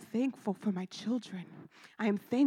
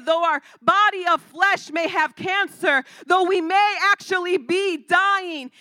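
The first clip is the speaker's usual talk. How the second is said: louder, higher, slow proclamation rising to a shout; preaching shout; 5 semitones higher, 9 dB louder than usual. Acted. Pastor declaring scripture over the sick: higher, louder shout. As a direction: proclaim it at full force.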